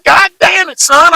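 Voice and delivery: an angry country dad hitting God hard. Retold or imitated. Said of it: "make 'em talk like a total hick".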